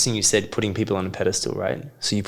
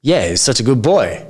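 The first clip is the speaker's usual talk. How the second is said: praising friends; 3 semitones higher, 6 dB louder than usual. Retold or imitated. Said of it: "his friends were like".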